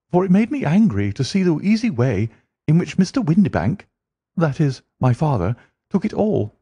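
high, heated complaint; Mary complaining about her stepfather, high and heated; indignant young woman. Acted.

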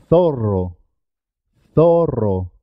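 long trilled rr in "Zorro"; marked double R. Shown as a demonstration. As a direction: make the RR of "Zorro" vibrate. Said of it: "Es importante marcar".

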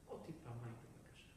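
low, hushed small request; meek mother. Acted. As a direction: fade almost to a whisper. Mother meekly asks once more.

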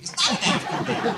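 put-on not an after baby, shouted; mock-outraged shout; loud correction. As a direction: bark out the correction.

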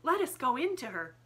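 put-on high, loud child's urging; excited young girl, pitched well up; eager little girl. A narrator's reading. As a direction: urge your father on excitedly. Sarah eager to enter shop.

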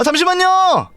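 high urgent call to hold on; frantic plea. Real, not acted. To the ringing phone while fleeing, frantic plea.

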